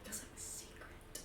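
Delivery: whispered secret. Acted. Kane hushing about the gift, whisper. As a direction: breathe it as a secret.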